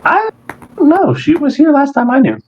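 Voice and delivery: high-pitched flustered voice; high character voice. Acted. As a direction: squeak it out nervously. GM voices old woman's assistant.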